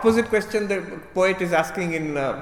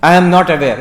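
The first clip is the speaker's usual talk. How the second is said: "am" pressed in a loud, falling "I am not aware."; loud demo declaration; 11 dB louder than usual. Shown as a demonstration. Teacher demonstrating the impossible claim, loudly. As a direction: announce it boldly to expose the contradiction. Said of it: "You are self-contradicting yourself".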